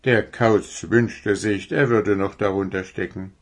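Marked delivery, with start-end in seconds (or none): none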